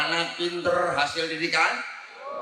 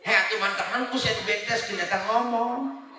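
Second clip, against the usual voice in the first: ordinary talk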